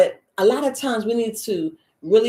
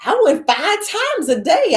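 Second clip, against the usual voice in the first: excited amazement; 8 semitones higher, 5 dB louder than usual. Retold or imitated. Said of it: "you're like".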